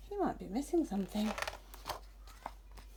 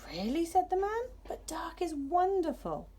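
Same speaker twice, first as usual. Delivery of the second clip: hushed wonder; 8 semitones higher than usual. Acted. Stargazer speaks of night.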